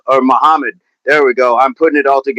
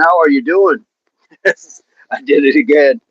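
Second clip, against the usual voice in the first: usual speaking voice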